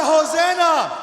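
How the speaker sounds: full-force shout, high and drawn out; loudest shout. Shown as a demonstration. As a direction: belt it out to whip up the crowd. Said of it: "say it louder".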